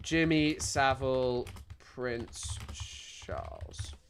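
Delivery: low, soft, drawn-out mumble to self; absent muttering. Real, not acted. Streamer searching for Savile and Charles videos, muttering slowly. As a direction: trail off slowly while typing a search.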